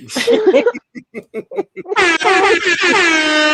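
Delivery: laughing high and long. Real, not acted. Laughing at his own joke to the chat. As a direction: burst out laughing.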